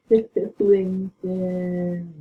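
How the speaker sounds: very slow, stretched name; long drawn-out call. Real, not acted. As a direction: draw the name out like a lament. Calling teammate's name again.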